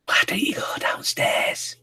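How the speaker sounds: soft whisper back. Pretend. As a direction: whisper to match him.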